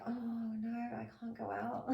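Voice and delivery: soft, drooping mock protest; mock reluctant moan. Homebody imitating herself refusing to go out, soft mock moan. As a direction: whine quietly that you can't face the cold. Homebody dreading going out in cold.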